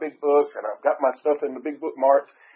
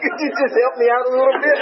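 higher and louder, laughing while pleading; laughing plea; 12 semitones higher, 7 dB louder, faster than usual. Retold or imitated. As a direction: beg through laughter. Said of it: "It's like".

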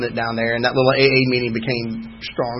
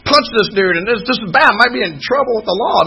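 higher, louder mock panic; freaking out; 8 semitones higher, 4 dB louder than usual. A man quoting his frantic sponsor at the meeting, mock panic. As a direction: retell a friend's frantic confession. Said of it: "Danny is freaking out".

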